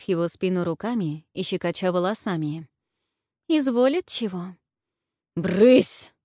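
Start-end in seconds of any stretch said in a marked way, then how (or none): 3.5-4.6 coy teasing higher voice; femme fatale. A narrator's reading. Наложница ластится к князю. 5.4-6.1 sharp loud shoo; gruff shout. A narrator's reading. Князь прогоняет девок.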